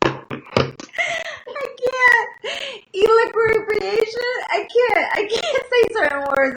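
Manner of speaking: high, squeaky laughing-crying; real tears of laughter over a hard word. Real, not acted. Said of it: "I'm crying".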